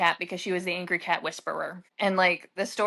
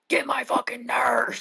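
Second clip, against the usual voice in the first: whispered mock yell; 5 semitones higher than usual. Patient swearing at her.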